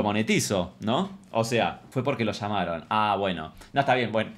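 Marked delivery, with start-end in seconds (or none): none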